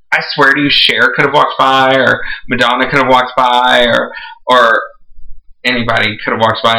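usual speaking voice

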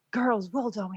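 put-on a shaky stab at an Irish accent. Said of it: "That's why I can't do Irish accent".

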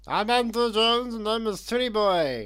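high, loud, drawn-out mocking impression; Andrew. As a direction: call it out slowly in a high-pitched voice. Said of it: "can you do an impression of Andrew?".

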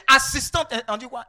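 ordinary delivery

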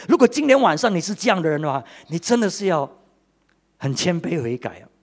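ordinary delivery

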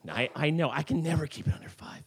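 whispered confession to the crowd; whispered aside. Real, not acted. Storyteller admits running long, whispered. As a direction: let it slip under your breath. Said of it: "aside to audience".

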